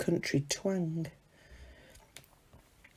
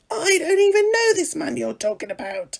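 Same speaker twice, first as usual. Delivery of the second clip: high, louder desperate cry of denial; panicked denial shout; 9 semitones higher, 4 dB louder than usual. Acted. Frightened Galilean shouting to deny Jesus, panicked. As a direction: shout it as if cornered and caught. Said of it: "finally shouting".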